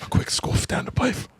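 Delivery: hushed, hammed-up British accent; badly faked accent. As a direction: half-whisper a bad London accent. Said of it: "Is that not how they say".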